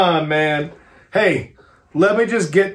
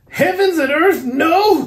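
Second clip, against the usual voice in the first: much higher, loud outraged no; passionate cry; 13 semitones higher, 6 dB louder, slower than usual. A narrator's reading. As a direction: refuse with full fury. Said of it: "cried Arthur in a storm of passion".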